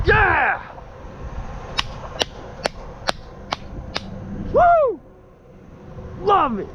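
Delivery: excited. Real, not acted. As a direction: cheer the catch. Another fish landed.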